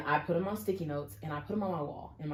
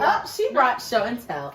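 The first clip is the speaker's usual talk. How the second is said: higher, louder, slowed teasing line; playful tease; 7 semitones higher, 10 dB louder than usual. Real, not acted. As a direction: rib her friend fondly. Teasing friend who brought journals.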